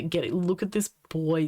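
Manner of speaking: ordinary talk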